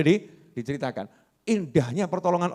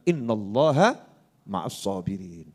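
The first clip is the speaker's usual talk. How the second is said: recited verse; 8 semitones lower, slower than usual. Acted. Quoting Quran verse in sermon.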